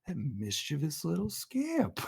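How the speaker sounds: soft, slow, fond drawl; amused Owen Wilson. Retold or imitated. Owen Wilson's agent forgiving Loki's theft, soft and slow, amused. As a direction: savor it slowly and quietly. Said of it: "He's like".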